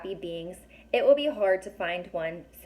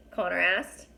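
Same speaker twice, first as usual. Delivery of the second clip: ordinary delivery, nothing marked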